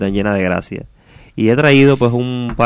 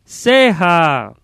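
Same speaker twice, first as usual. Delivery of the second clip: high, drawn-out distant call; echo; 7 semitones higher, 3 dB louder than usual. Acted. Voice answering from the other side, echoing call. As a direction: stretch it out like an echo, falling at the end. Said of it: "el eco es todo".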